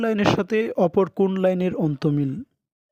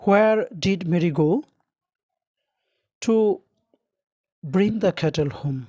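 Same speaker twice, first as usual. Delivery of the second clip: slow question read; much slower than usual. Shown as a demonstration. Teacher quotes exam question.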